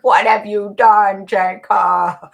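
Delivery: loud, slow, accusing demand; angry demand. Retold or imitated. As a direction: confront him like a furious responder. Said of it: "and they were pissed".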